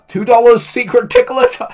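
a streamer shrieking at a donation alert; excited squeal. Real, not acted. Streamer to chat, reading the tip out in a squeal.

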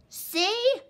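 high, loud shout. A narrator's reading. Little Wolf to his parents, triumphant shout. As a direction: shout to prove he's right. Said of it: "Little Wolf shouted".

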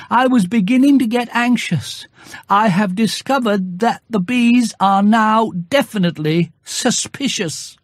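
high, earnest little bear asking a favour; a bear plotting to fool the bees; Pooh Bear voice. A narrator's reading.

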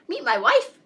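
high, loud introduction; excited storybook bird; proud announcement. A narrator's reading. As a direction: show off your bride excitedly. Bird proudly introduces his new wife.

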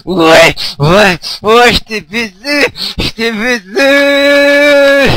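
slow, high, loud gloating yell; triumphant shout. Real, not acted. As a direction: gloat loudly over the beaten level. Victory taunt at the game. (cri de triomphe moqueur)

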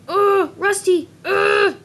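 high, slow, loud groaning cry of frustration; frustrated outburst. Acted. Jealous Smudger groans about Rusty to friends, a loud frustrated wail.

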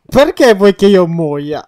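loud, slow, drawn-out complaint; mock wailing protest. Player protests to partner wishing them dead, mock wail.

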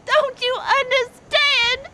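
desperate, wailing protest; anguished anger. Acted. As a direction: cry out at the unfairness. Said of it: "I'm so mad!".

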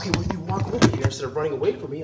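ordinary talk

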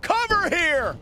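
excited call. Real, not acted. Commentator calls the pin.

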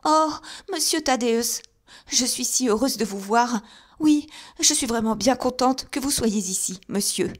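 put-on old housekeeper greeting her master in high relief; gushing with joy at his arrival; relieved old housekeeper. A narrator's reading. Old housekeeper greets Thaddeus at the door, high and delighted. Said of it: "s'ébrouer d'aise en le voyant".

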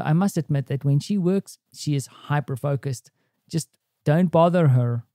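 usual speaking voice

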